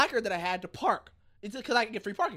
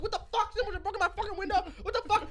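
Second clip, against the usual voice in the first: high, quick comic panic; retold panicked outrage; 12 semitones higher than usual. Car owner calling the cops about his window, high quick mock panic. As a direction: rattle it off pitched way up.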